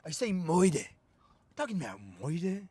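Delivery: soft, mock Italian-Chicago mobster accent; Colin Farrell's Penguin. Mocking the Penguin's merged accent, fake Italian-Chicago impression. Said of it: "It's fake Italian, fake Chicago".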